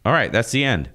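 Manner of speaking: ordinary delivery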